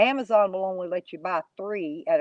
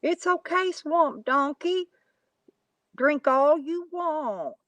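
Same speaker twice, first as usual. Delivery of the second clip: mock consoling; 8 semitones higher, slower than usual.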